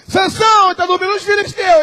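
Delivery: high, loud warning cry; alarmed shout. Acted. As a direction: shout him awake in alarm. Delilah calling Samson awake. (Dalila gritando para acordar Sansão)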